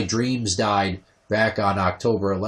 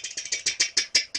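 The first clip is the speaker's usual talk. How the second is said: car ticking noise; 6 dB quieter than usual. Retold or imitated. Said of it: "making this tick".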